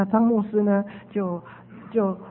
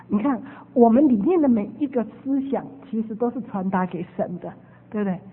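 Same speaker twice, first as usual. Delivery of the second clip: ordinary talk, nothing marked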